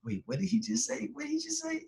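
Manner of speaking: high-pitched, incredulous double-check; confused and amazed, pitch shooting up; stunned disbelief. Retold or imitated. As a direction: replay the shock, climbing into a squeak. Acting out disbelief at the call.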